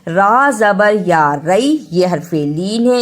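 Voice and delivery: ordinary talk, nothing marked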